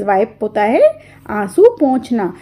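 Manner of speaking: ordinary delivery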